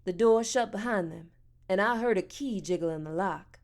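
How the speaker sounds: ordinary delivery, nothing marked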